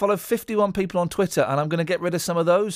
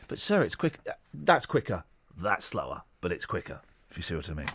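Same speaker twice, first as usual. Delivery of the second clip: deep mumbling clerk; 11 semitones lower, 7 dB quieter than usual. Retold or imitated.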